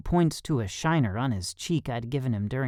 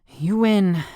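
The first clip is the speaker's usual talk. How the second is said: higher, louder gasped surrender; gasping; 6 semitones higher, 6 dB louder than usual. A narrator's reading. Panting on the floor after the fight, gasping. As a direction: gasp it out, winded. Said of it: "I gasp".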